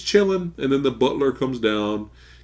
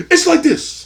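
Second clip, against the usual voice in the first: high, loud theatrical mockery; over-the-top taunting demonstration; hammed-up movie villain; 7 dB louder than usual. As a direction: launch a gleeful film impression. Impersonating Daniel's milkshake speech.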